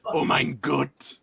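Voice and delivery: low, slow, lightly German mock exclamation; a hint of a German accent, drawn out. To the friends, joking about hell, in a mock German accent. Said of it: "first time I've ever heard a German Scot".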